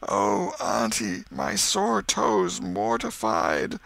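slow wail climbing high; hammed-up, drawn-out suffering; feigned agony. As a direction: whine it out pitifully. Tom announces his fake ailment.

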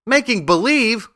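high, incredulous echo; crying out. A narrator's reading. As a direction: repeat it as a shocked, high-pitched question. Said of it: "cried Dorothy".

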